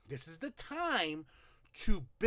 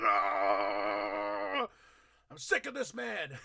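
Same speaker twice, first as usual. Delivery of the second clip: mock angry groan; 4 semitones higher, much slower than usual. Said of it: "got that angry face".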